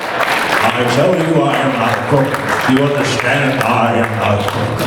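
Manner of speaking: lightly voiced Nixon, denying it; a hint of the old president insisting; Nixon impression. For the panel he slips into a light Nixon denial. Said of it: "former President Richard Nixon".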